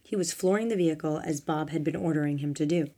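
usual speaking voice

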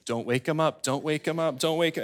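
soft, hushed parent by the crib; softly chanted under the breath; hushed pleading. Acted. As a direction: keep it down, baby's asleep. Parent laying sleeping baby down.

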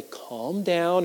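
softly voiced, mild soothing; calming someone down. Someone to a worked-up person, soft calming. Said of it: "someone comes to you when you're really worked up".

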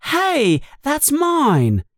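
put-on high-pitched boy's protest; indignant protest. A narrator's reading. Harry objects as his letter is grabbed, indignant protest.

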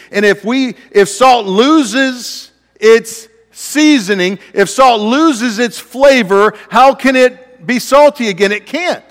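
high, loud, slow preaching; impassioned preacher's rising cry; preaching climax. Acted. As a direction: build the salt point to full force.

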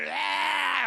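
high, loud mock scream; imitating a screaming kid; kids' scream. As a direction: shriek like a scared child. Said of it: "They're screaming".

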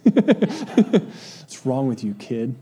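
slow, laughing mock scolding of a kid. Parent scolding a kid over dropped ice cream, laughing mock. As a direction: tease the kid through a laugh.

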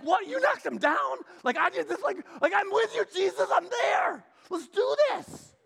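eager shout. Retold or imitated. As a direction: hype yourself up beside your leader. Peter eager for the fight.

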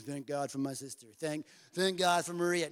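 low, flat, grudging recital; mock a child saying it without meaning it; imitating an unconvincing forced thank-you; not meaning it. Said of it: "No, you need to say it like you mean it".

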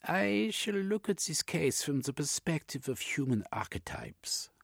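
high, airy analyst voice; Jung the analyst. Acted.

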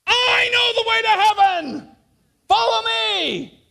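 high, loud, drawn out call; yelling. Retold or imitated. Blind guide yelling to campers, high and loud. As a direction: bellow it across the camp, slow on follow me. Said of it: "I began to yell".

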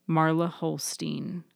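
plain reading voice

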